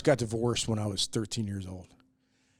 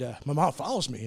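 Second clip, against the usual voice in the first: ordinary talk, nothing marked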